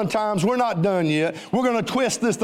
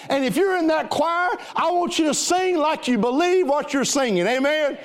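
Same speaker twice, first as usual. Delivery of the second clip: higher, building exhortation; rally the crowd toward victory; preaching at full force; 5 semitones higher than usual. Acted. Pastor urges the choir to sing with belief, preaching hard.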